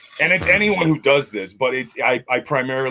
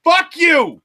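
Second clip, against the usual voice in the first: much higher, loud indignant shout; mock furious outburst; 12 semitones higher, 5 dB louder than usual. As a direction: yell it at the accent in comic outrage. Mock rant at the accent.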